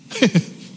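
quick high chuckle; short laugh. Real, not acted. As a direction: laugh it off lightly. Laugh alone.

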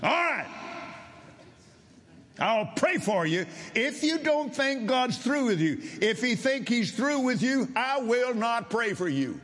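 high, ringing, forceful command; roaring preacher. Retold or imitated. Preacher sets his condition for praying, roaring. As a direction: lay down terms in a high roar. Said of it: "he was not speaking softly".

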